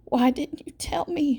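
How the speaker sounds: angry, pained demand; anguished demand. A narrator's reading. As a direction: demand it with anger and pain. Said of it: "she demands".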